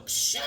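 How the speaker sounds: drawn-out vocal window crash; crash sound. Retold or imitated. Retelling the hero's jump through a window, imitated crash. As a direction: imitate glass bursting as a shushing burst.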